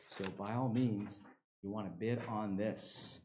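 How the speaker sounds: trailing off soft and low, slow; mumbled. Real, not acted. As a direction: say it under your breath. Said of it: "What was that, [name]?".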